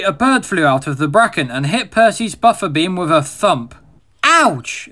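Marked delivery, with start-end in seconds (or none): 4.2-4.9 sharp, higher yelp of pain; startled exclamation. Acted. High startled yelp of pain. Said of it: "exclaimed Percy".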